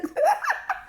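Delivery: sharp, high burst of laughter; incredulous laugh. Real, not acted. Laughing mid-story.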